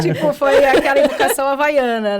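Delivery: telling the story through big laughs; laughing loudly. Real, not acted. Laughing about childhood slipper beatings.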